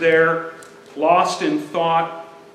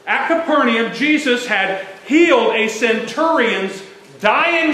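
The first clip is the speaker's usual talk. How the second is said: building sermon; 5 semitones higher than usual. Acted. Pastor telling the church of Jesus' miracle, building preaching. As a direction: build the fire line by line.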